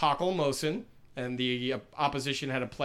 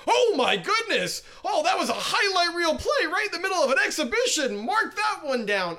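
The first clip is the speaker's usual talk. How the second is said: high, loud, hammed-up broadcaster yelling; over-the-top play-by-play excitement; mock excited announcer; 14 semitones higher, 5 dB louder than usual. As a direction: imitate a commentator leaping from his seat. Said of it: "they jump out of their seats".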